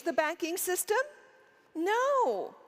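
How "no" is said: normal talking voice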